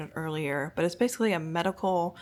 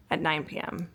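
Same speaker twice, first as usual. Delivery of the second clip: usual speaking voice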